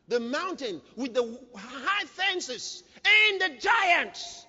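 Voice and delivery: slow build to a shout; emphatic preaching shout. Real, not acted. Preacher stressing how hard the mountain is, slow emphatic shout. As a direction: stretch each obstacle out, rising in force.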